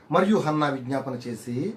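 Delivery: slow, solemn Bible recitation; scripture reading. Acted. Preacher reading Hannah's song to the church, slow solemn. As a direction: read the verses slowly as sacred words.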